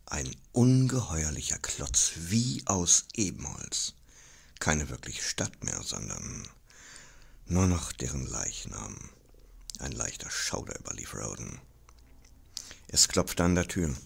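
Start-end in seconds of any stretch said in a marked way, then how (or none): none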